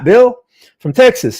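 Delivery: "Bill" pitched high and loud in a hammed-up Texas accent; mock Texas accent. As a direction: greet Bill in a jokey Texan voice. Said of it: "making fun of my, my Texas accent".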